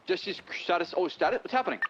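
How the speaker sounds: ordinary talk, nothing marked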